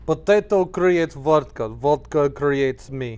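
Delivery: slow, deliberate mock Russian accent; Soviet accent. Co-host joins the potato joke in mock Soviet accent. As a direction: proclaim it slowly like a comic Soviet. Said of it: "talks like somebody that lived in the, like, Soviet Union".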